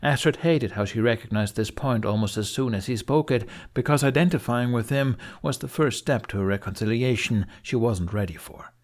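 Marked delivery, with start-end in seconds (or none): none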